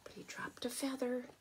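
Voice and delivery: hushed storytelling whisper. A narrator's reading. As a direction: lean in and whisper it.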